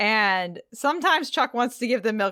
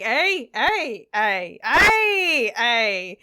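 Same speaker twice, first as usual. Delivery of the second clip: Canadian hey, repeated cheerfully and loud; mock Canadian calling out hey; playful Canadian hey, hey, hey; 2 semitones higher, 5 dB louder, much slower than usual. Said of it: "stereotypical Canadian shit".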